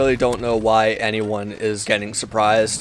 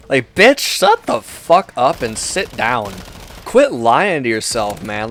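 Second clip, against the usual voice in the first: exasperated telling-off; 2 semitones higher, slower than usual. Acted. Ranter to hyped-up fans, exasperated scolding. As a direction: burst out at them, then slow down for emphasis.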